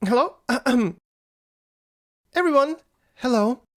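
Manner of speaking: high-pitched, halting greeting to a crowd; tentative public speaker. Acted. Nala tests the microphone before crowd.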